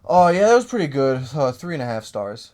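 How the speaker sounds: mock dismissive friend, high and loud; lightly voiced lazy critic; imitating friend. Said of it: "and he'll go".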